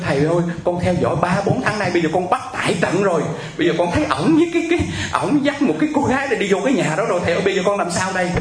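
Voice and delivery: high, breathless-sounding rush of complaint, imitated; frantic wailing. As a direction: act out a distraught caller pouring it all out. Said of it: "nghe bên kia khóc gào lên". (giả giọng kêu gào kể lể)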